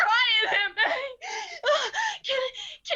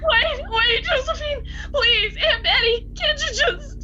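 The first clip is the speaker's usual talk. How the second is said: desperate crying plea; 4 semitones higher, 4 dB louder than usual. Acted. Mother begs to hold baby.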